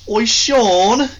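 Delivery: high, loud Australian call; a mock Australian yell across the room; Australian shout. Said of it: "I can't do, like, the high pitched".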